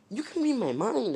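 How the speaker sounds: high-pitched disbelief; acted shock, pitch jumping up; astonished. Deku finding the system in his head, astonished.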